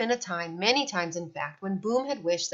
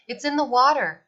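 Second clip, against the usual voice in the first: urgent call; 6 semitones higher, 6 dB louder than usual. A narrator's reading. Boom alerting the others.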